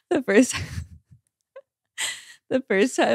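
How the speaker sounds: laughing through words; giggling, can't get it out. Real, not acted. Laughing while telling.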